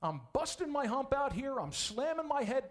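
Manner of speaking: high, loud, fed-up complaining prophet; exasperated Elijah. Acted. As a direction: vent like a worn-out man at the end of his rope. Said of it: "He said exactly the same thing".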